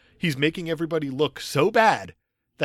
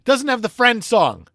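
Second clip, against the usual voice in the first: put-on higher, slow little-kid voice; imitating kid; 9 semitones higher, 6 dB louder, slower than usual. Dad imitating his daughter in a high, slow mock kid voice. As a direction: mimic a small child complaining. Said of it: "That's how my kid sounds".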